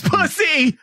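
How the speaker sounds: sudden high yell; shouted punchline. Acted.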